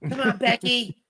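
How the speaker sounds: high, loud cackling witch voice; wicked witch voice. Retold or imitated. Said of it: "sound like the wicked witch of the west".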